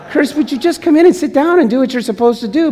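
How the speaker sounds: teasing a church member by name, playful scolding; mock scolding. Real, not acted. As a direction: call him out with playful exasperation. Calling out a congregant.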